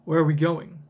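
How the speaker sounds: ordinary talk, nothing marked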